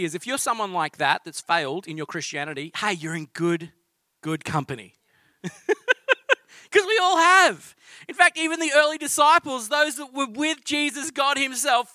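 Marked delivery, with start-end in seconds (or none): 5.4-6.3 high burst of laughter. Real, not acted. Laughs at shared failure.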